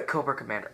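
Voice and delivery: name hidden inside a fake cough. Toy reviewer hinting at the lookalike, fake cough. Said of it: "excuse me if I'm coughing".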